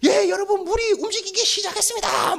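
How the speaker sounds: high, urgent live-report voice; excited TV reporter. Acted. Reporter tells viewers the water moved, excited live report. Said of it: "다 와서 촬영하는 거예요".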